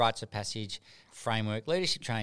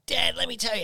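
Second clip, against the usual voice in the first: put-on eager kid; 9 semitones higher, 4 dB louder than usual. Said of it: "and he goes".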